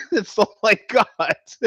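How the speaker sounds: high, laughing admission; helplessly amused, pitched high. Real, not acted. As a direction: say it through the tail of a laugh. Laughing at own accent attempts.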